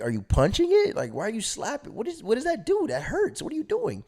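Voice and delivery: high-pitched baffled questioning; quizzing a friend in high-voiced disbelief; confused. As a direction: imitate your bewildered younger self. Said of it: "Bro, I'm confused".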